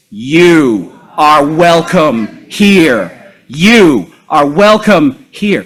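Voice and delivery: loud, slow, heartfelt proclamation; emphatic, like meaning it. Shown as a demonstration. Leader sending welcome to the absent and disliked, emphatic call. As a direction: call each word out slowly, meaning every one. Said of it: "like you mean it".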